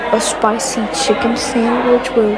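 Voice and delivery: loud, eager fast-food cashier. Acted. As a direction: shout the order back like a cashier.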